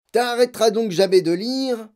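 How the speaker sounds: loud, high, fed-up father snapping; angry jab. Acted. Father snapping at his reading daughter, loud and angry. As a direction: fling it at her in irritation. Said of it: "lui lança-t-il".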